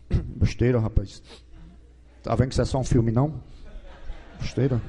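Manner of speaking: low, scoffing husband brushing it off; dismissive husband. Acted. Husband scoffing at his crying wife, low and dismissive. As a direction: play the tough husband who won't cry, deep and gruff. Husband voiced in teasing skit.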